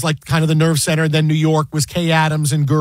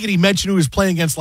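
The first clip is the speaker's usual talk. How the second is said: usual speaking voice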